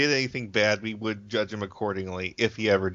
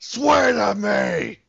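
Batman shout; 9 semitones higher, 5 dB louder, much slower than usual. Acted. Batman interrogation quote, shouted.

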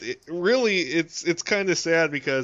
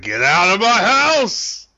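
big slow bellow, higher and much louder; Jackie Gleason; 7 semitones higher, 10 dB louder, slower than usual. Acted. Enraged star throwing Alan Reed out, bellowing. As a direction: roar it, stretching out the words. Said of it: "Jackie Gleason's like".

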